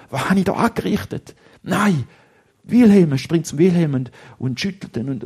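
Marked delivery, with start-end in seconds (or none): 0.1-3.1 higher, louder call of his friend's name; boy calling his unconscious friend in panic; horrified cry. Acted. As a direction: cry out in shock. Friend lies motionless, horror.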